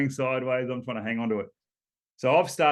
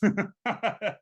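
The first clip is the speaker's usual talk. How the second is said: laugh; 8 semitones higher than usual. Real, not acted. Laughing at being beaten by two minutes.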